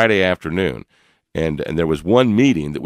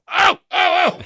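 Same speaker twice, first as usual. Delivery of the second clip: squealing oh, pitched way up and louder; excited oh; 5 dB louder, slower than usual. Retold or imitated. Reliving having a rock star in his car, excited squeal. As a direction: burst out with giddy excitement. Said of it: "I was so excited".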